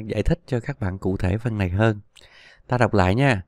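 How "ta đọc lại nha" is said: normal talking voice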